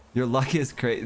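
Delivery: usual speaking voice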